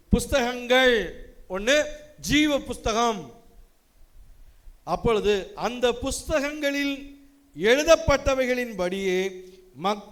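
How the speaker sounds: solemn, lifted reading voice; preacher reading Revelation aloud to the congregation, solemnly; scripture reading. Acted. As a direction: proclaim the vision from Revelation. Reads scripture.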